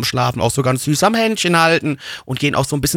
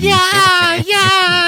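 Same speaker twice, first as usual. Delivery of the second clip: put-on crying kid; 4 dB louder than usual. Sketch, kid answering.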